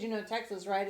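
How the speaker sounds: ordinary talk, nothing marked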